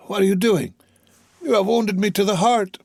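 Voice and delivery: put-on high, pleading old man voice; wounded, pleading cry; pained old father. A narrator's reading. Old father's anguished protest.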